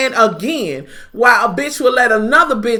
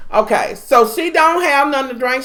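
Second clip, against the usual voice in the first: ordinary talk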